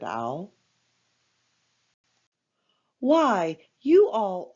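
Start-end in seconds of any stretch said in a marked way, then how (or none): 3.0-4.5 high, loud glad outburst; exclaiming. Acted. Pooh telling his friends they're why, with a glad loud exclaim. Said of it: "exclaimed Pooh".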